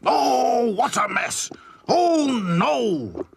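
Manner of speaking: high, loud wailing boss; distraught Grimes. A narrator's reading. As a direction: cry out in despair.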